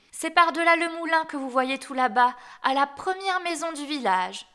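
put-on little girl. A narrator's reading. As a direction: answer brightly pitched as a trusting child. Child gives directions to wolf.

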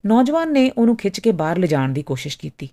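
ordinary delivery, nothing marked

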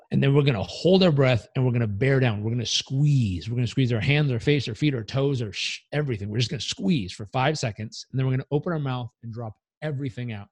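loud coaching breath instructions; guided breathing. Shown as a demonstration. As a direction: lead the breathing drill with energy. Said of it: "Here's how we'll do it".

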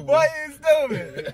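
high, slow laughing. Real, not acted. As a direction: laugh through the words. Laughing at friend's joke.

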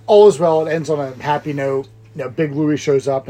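usual speaking voice

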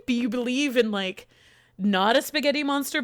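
normal talking voice

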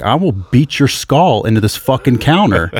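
loud, high, raging threats at a man; furious retold yelling. Retelling blowing up at a rude man, furious yelling. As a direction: blow up and yell it at him full force. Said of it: "and then I finally blow up".

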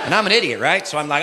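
normal talking voice

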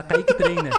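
high, loud, laughing announcer call; mock announcer shout. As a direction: belt out the name like a hype intro. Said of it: "o cara chega lá". (grito de apresentador zoando, rindo)

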